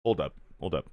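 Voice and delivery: low, soft mock halt order; retold quiet "wait"; hushed command. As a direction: stop them quietly, sensing something. Voices Reva halting troops.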